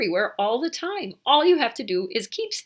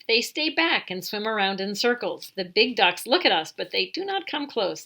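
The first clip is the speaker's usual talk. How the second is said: usual speaking voice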